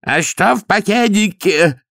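high, slow shout; yelling. A narrator's reading. Downey yelling at Vimes about the packet. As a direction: shout it, pointing at the desk. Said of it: "заорал Дауни".

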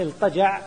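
الطجع pronounced iltaja', l with no vowel; vowelless lam. Shown as a demonstration. Teacher explaining the substituted letter, الطجع with a still lam. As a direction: let the l sound before ط in الطجع. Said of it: "اللام الساكنة".

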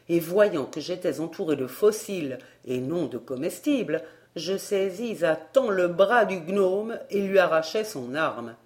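ordinary delivery

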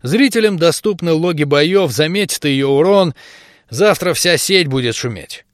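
plain reading voice

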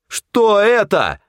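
high, drawn-out angry outburst; angry bark. A narrator's reading. Hunter cutting off the crony's excuse, angry bark. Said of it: "рявкнул я зло".

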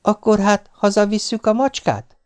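high-pitched wheedling question; coaxing wife. A narrator's reading. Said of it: "Arcát oda dörzsölte a karomhoz, és így szólt".

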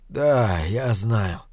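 weak, low and soft reply; mildly voiced wounded man struggling to speak; gurgling words. A narrator's reading. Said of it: "Слова его перемешивались с клокотанием".

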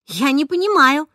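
громче и выше, возмущённо; вспыхнуть от возмущения. A narrator's reading. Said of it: "возмутилась я". (дочь возмущается словами мамы)

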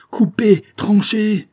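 hurlé très haut et très fort; cri paniqué. A narrator's reading. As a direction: crie l'ordre dans la panique. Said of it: "criai-je à Paula". (le narrateur hurle l'ordre à Paula)

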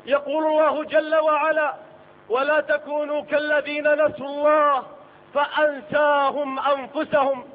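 normal talking voice

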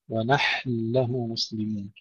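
slow "ونحن له", ha held vowelless then doubled lam; still ha. Shown as a demonstration. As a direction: sustain the ha of "ونحن" and land on the merged lam. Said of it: "المحافظة على سكون الحاء".

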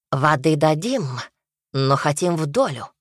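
usual speaking voice

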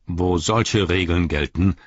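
stern old wizard, lightly voiced; a grave wizard rebuking an envoy; a hint of a stern old wizard; Gandalf's stern voice.

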